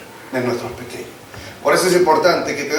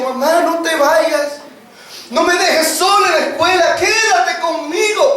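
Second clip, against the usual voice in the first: little one begging mom not to leave; crying child; 11 semitones higher than usual. Retold or imitated.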